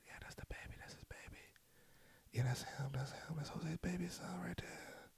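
hushed whispers about the new guy; imitating people whispering behind your back; gossiping whisper. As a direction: whisper like relatives pointing you out. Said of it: "you see people looking at you".

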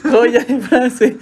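talking through laughter; laughing. Real, not acted. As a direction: squeeze the words out between laughs. Laughing hard at the quote. (riéndose a carcajadas)

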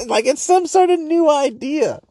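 high, put-on voice mocking a podcaster; mocking high-pitched imitation of a smug guest; mock pompous podcaster. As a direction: sneer it out in a lofty fake voice. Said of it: "repeats the phrase mockingly".

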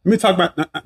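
normal talking voice

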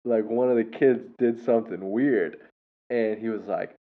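ordinary talk, nothing marked

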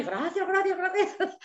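a hint of crying gratitude; retold tearful thanks. Writer retells thanking Amazon through tears, mildly teary. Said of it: "ahí empecé ya a llorar".

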